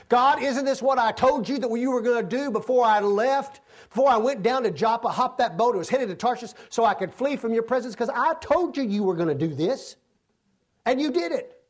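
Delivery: high, loud, fast griping at God; Jonah's complaint. Acted. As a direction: whine it out like a sulking prophet.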